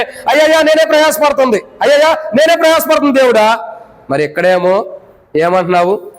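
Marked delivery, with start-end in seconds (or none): 0.0-3.8 whining complainer. Retold or imitated. Mocking a burdened believer's complaint.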